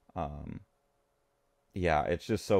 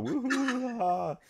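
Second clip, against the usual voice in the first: very high, giddy group whoop; mock whooping. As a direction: imitate rowdy women cheering and laughing. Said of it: "they're all".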